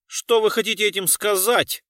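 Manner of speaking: loud, high, affronted question; haughty. A narrator's reading. Culture worker challenges the detective about missing luggage, haughty. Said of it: "высокомерно спросил работник культуры".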